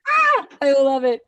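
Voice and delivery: gushing with real emotion; overjoyed. Real, not acted. Host moved by praise.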